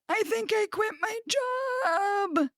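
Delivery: high, slow, drawn-out mock announcement; sing-song it high, stretching every word; high singsong. Acting out her own giddy decision.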